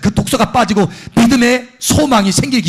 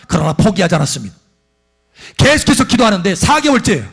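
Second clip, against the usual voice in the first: normal talking voice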